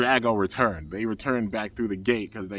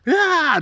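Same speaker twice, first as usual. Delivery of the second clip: sudden high yowl like a clawing cat; a cartoon cat's screech; mock screech; 10 dB louder than usual. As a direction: shriek it out, high and loud. Cat-like Fenneca claws at gate.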